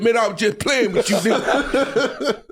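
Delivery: laughing backpedal. Retold or imitated. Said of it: "He said".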